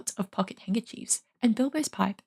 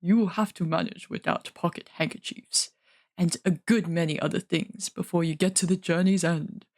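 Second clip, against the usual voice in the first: gruff dwarf; 4 semitones lower than usual. Acted. Dwalin reassuring Bilbo about handkerchiefs, low dwarf voice. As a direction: speak low and steady like a dwarf.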